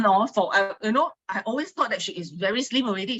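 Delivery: usual speaking voice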